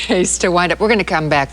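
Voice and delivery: ordinary talk, nothing marked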